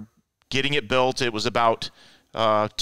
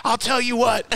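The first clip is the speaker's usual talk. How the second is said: high-pitched, worked-up comeback; retold angry retort; 11 semitones higher than usual. Driver answering the yelling race boss, heated retort. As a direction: fire back in a mock argument. Retold phone quarrel, firing back.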